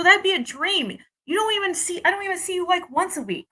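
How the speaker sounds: high-pitched, disbelieving re-enactment; high, incredulous retort to his answer; retold incredulity. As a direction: replay your protest, pitched well up. Retells own incredulous reply to him.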